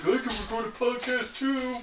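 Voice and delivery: high, silly dog voice; voicing the dog. Owner playfully speaking as her dog. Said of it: "He's like".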